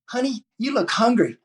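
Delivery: a waitress calling him honey, high and sweet; friendly waitress. Retold or imitated. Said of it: "You walk into a restaurant and say".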